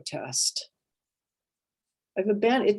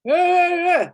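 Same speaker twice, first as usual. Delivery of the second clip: loud, high mock cry; acted high wail; put-on crying wail; 10 semitones higher, 8 dB louder than usual. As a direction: force out a wail of protest. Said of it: "I'm gonna start crying".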